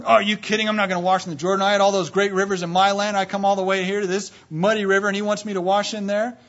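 loud, high incredulous protest; indignant. Acted. Naaman refusing to wash in the Jordan, loudly indignant. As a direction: pour out an affronted, disbelieving complaint.

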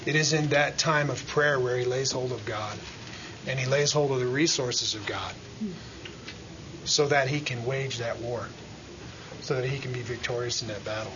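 soft, low sermon voice; quiet preaching. Acted. Preacher turning inward to the congregation, soft and low. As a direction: drop it down and draw them in.

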